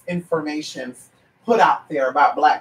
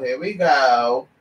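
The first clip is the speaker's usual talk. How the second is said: here we go stretched out slow and lower; slow low drawl; 6 semitones lower, much slower than usual. Real, not acted. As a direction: let it sink, slow and lower. Reacting to a caller's rundown.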